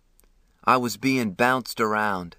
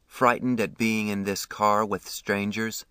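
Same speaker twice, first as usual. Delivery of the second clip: plain reading voice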